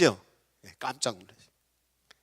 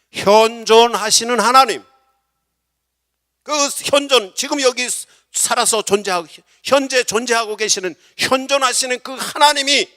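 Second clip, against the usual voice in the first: loud, high, emphatic proclamation; raised-voice sermon delivery; full-force preaching; 9 semitones higher, 11 dB louder than usual. Real, not acted.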